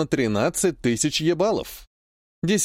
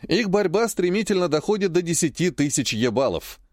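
ordinary delivery, nothing marked

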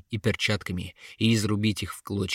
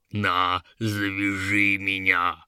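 stupid troll; 2 semitones lower, slower than usual. A narrator's reading. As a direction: offer it like a dim, amused troll. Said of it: "хохотнул он".

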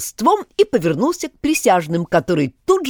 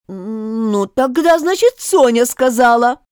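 anxious; 7 semitones higher, 5 dB louder, slower than usual. A narrator's reading. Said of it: "продолжал болванчик с тревогой".

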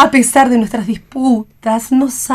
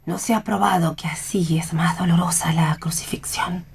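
softer, lower whisper; 4 semitones lower, 6 dB quieter than usual. Acted. Mother whispers a dark aside about the crucifixion. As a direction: say it as a quiet aside.

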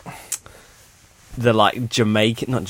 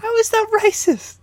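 incredulous. Real, not acted. Incredulous at racism claim.

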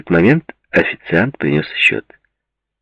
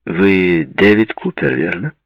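slow, higher-pitched hail; loud American voice; 5 semitones higher, 4 dB louder, slower than usual. A narrator's reading. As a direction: call it out slowly, a touch loud. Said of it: "громкий голос с американским акцентом".

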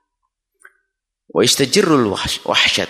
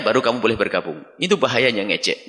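ordinary delivery

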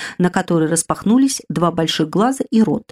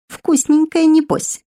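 high, louder hungry squeal; greedy little spirit; 11 semitones higher, 3 dB louder, slower than usual. Acted. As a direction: savour the word like food. Spirit eyes her hungrily. (высоко, жадно)

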